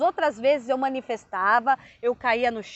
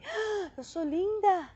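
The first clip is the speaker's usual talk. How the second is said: slow, higher gasp of wonder; amazed self-discovery; 5 semitones higher, 4 dB quieter, slower than usual. Retold or imitated. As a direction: re-enact marvelling at your own reflection, drawn out. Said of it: "Quando eu me olhei, eu falei". (espanto encantado, lento e mais agudo)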